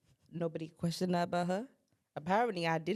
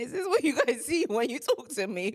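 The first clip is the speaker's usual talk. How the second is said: laughing disbelief; 6 semitones higher, 4 dB louder than usual. Retold or imitated. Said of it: "I'm like".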